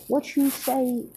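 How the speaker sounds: high, riled-up challenge; riled Naruto challenging his brother; indignant retort. Acted. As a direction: snap back, pitched high. Naruto bristles at insult.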